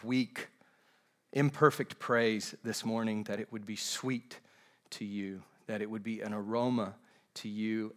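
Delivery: soft, measured prayer with long pauses; hushed pleading to God, dropping at phrase ends; pastoral prayer. Real, not acted. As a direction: pray aloud for the congregation, low and unhurried. Leads a congregational prayer.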